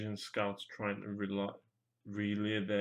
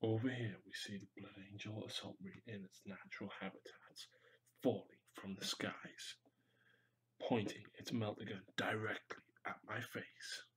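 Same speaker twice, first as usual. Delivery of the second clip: hushed wildlife narrator whisper; nature documentary; 2 semitones lower, 13 dB quieter than usual. Pretend.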